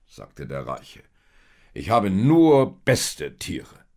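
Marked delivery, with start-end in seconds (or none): 1.7-3.9 loud, slow, drawn-out boast; boastful rich man. A narrator's reading. Rich seller refuses Josef a cheaper donkey, boastful. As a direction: lean proudly and slowly on the words.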